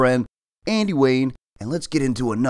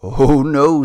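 dread; 5 semitones higher, 9 dB louder than usual. Acted. Cat dreading the wolf.

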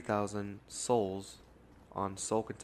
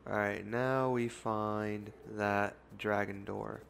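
usual speaking voice

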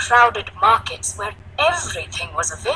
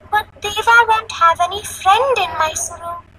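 put-on high, loud child's objection; worried little girl; 12 semitones higher, 5 dB louder than usual. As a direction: protest in a small girl's high voice. Child worries for her elephant friend.

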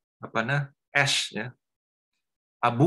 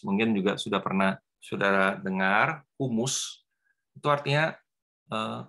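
choppy dropping-out connection audio; breaking up space radio; slower than usual. Real, not acted. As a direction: voice cutting in and out. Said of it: "putus, Pak".

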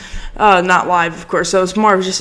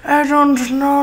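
put-on voice; 5 semitones higher, slower than usual. Shown as a demonstration. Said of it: "I say".

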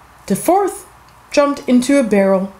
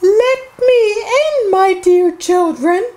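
very high, put-on sing-song mother voice; wolf posing as mother; 10 semitones higher, 3 dB louder than usual. A narrator's reading. Wolf at the door to the seven kids, high pretend-mother voice. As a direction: coax in a fake high mother's voice. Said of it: "a voice said".

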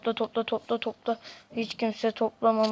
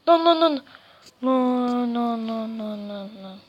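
panicked no; 6 dB louder than usual. Real, not acted. Dying in the game, loud panicked no. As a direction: shout no in panic.

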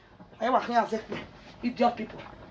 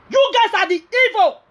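high, slow, pointed accusation, louder; angry accusation; 8 semitones higher, 4 dB louder than usual. Real, not acted. Scolding the gossips on social media, slow and angry. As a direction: spit the blame out slowly at them.

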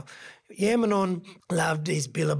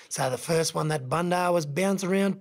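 ordinary delivery, nothing marked

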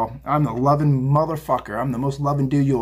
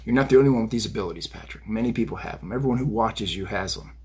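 lower, softer entity addressing Patrick; channeled spirit voice; 4 semitones lower, 6 dB quieter than usual. Pretend. Spirits rebuking Patrick during his channeling, low and hushed. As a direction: speak as the voice coming through. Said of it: "Channeling everyone".

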